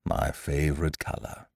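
soft, subdued, falling away; a quiet private regret; murmured to himself. A narrator's reading. Eeyore remembering his favourite colour, murmured to himself. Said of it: "he murmured to himself".

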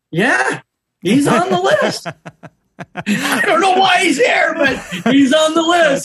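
high, amazed, laughing disbelief; surprised check-in woman. Retold or imitated. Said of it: "she looks up with a surprised look and she goes".

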